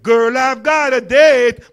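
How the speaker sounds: drawn-out proud announcement; slow, loud boast. Retold or imitated. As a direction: boast it slowly and loudly. Uncle quoted, slow and loud.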